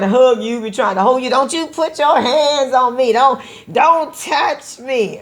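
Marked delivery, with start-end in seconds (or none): 1.3-5.2 higher, indignant refusal, slowing at the end; hot-tempered outburst. Acted. Wife refusing a hug from her partner, acted indignant. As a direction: act out a hot-headed spouse pushing touch away. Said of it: "hot-headed and got a temper and you say".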